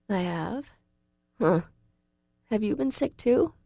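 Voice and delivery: sympathetic. A narrator's reading. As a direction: gently ask him, weak but caring. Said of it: "I ask him sympathetically".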